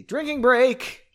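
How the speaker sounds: slow, high, loud sing-out; playful announcement. Pretend. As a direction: call it out like a big announcement. Reader announces a break playfully.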